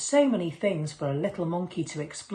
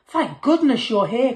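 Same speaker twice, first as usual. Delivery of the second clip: relieved cry; 7 semitones higher, 8 dB louder than usual. A narrator's reading. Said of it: "cried the cook".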